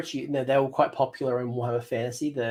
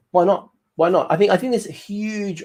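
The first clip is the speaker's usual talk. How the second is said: excited; 7 semitones higher, 6 dB louder than usual. Real, not acted. Excited rhetorical push for zombies.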